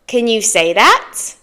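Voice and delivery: usual speaking voice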